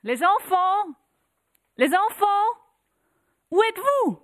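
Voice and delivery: high, loud desperate call; mother calling out. Acted. Mother searching the house for her children, calling loudly. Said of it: "et cria".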